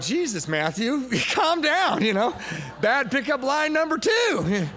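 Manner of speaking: high, loud inner panic, slowed down; panicked self-scolding. Retold or imitated. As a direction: yell at yourself to calm down. Scolds himself in his head.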